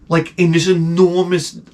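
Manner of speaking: ordinary talk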